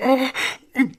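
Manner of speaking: ordinary delivery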